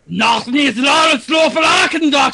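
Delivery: German Cthulhu. Acted. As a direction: rant like a German-accented Cthulhu. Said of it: "German Cthulhu, I guess?".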